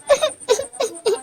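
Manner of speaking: high broken cry; a sudden sobbing wail; high crying wail with no words; wailing sob. Acted. Sob after lament.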